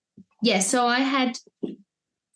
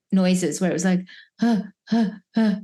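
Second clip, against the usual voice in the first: panting noises. Retold or imitated. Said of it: "doing some sort of panting".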